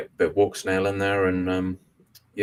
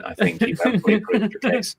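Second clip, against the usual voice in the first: amused teasing; 4 dB louder than usual. Real, not acted. Teasing after the chair story.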